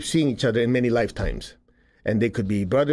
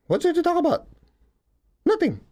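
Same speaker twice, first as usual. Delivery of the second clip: much higher, airy mock "What's there to talk about?"; mock high retort. Teasing listeners who cling to their grudges, high mock retort. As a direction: toss it off in a silly high voice, teasing. Mocking repeat of own line.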